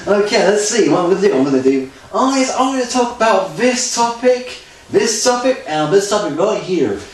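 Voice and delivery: high, loud mock-presenter voice. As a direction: act out announcing topics, pitched high and loud. Acts out reading from a script.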